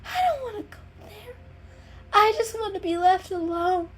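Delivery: high, loud, teary sarcasm from a sick kitten; a sick cat snapping back through tears; sick, sarcastic kitten. A narrator's reading.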